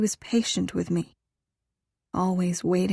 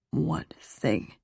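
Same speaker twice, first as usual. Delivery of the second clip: lower, softer male lover; male lover's voice; 4 semitones lower, 5 dB quieter than usual. A narrator's reading. He prompts her to name it, low soft male voice. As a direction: ask it low and intimately.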